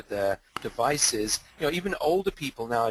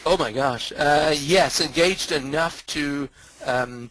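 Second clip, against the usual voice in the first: emphatic; 2 semitones higher, 7 dB louder than usual. Real, not acted. Emphatic answer about using social media.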